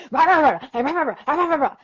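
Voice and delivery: loud, high "rah-rah" babble; mock ranting noise. Woman imitates her old political rants, mock worked-up yelling. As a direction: imitate yourself worked up, noisy and wordless. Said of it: "I would just get real excited".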